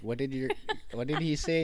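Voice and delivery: lightly voiced baffled outsider; puzzled friend. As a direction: ask it bewildered. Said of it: "your friend looks at you and like".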